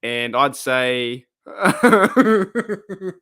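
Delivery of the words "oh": a loud higher laugh into "oh". Real, not acted. Streamer cracking up at his own rugby joke, laughing. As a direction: crack up mid-sentence.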